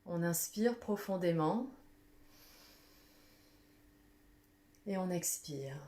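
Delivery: calm guided visualization, unhurried; guided meditation. Acted. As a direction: lead the group gently through breathing and imagining. Leads a guided breathing visualization.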